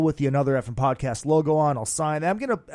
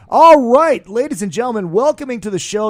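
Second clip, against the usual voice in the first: loud, high guest introduction; announcer voice; 8 semitones higher, 10 dB louder than usual. Acted. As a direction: bring the guest on like a host.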